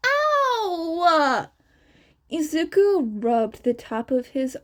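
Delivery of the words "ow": sharp yelp of pain from a punch; a quick hurt cry. Acted. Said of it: "Shinso had punched him".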